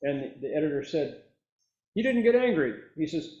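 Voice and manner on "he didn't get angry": high, loud astonished announcement; amazed exclamation. Retold or imitated. Editor marvelling at the reporter, loud high amazement. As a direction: exclaim it as the stunned onlooker.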